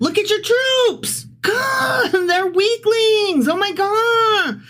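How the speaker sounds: very high, drawn-out comic wail; mock dismay. Host jeering at the doomed city's troops, high mock dismay. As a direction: wail it in a squeaky-high, exaggerated falsetto.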